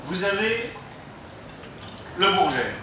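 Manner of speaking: ordinary delivery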